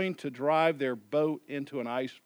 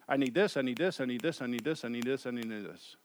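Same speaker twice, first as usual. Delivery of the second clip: rattled-off list; 6 semitones lower, 8 dB quieter, faster than usual. Acted. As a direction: run the repeats together, trailing lower and softer. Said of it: "He just rips it off".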